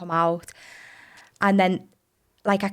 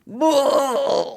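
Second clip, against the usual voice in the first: labour cry; 6 semitones higher, 4 dB louder than usual. Retold or imitated. Pushing during epidural.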